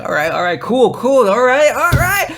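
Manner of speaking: escalating mock enthusiasm; keen agreement, building. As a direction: build the all-rights into a squeal. Acts out keen agreement, rising.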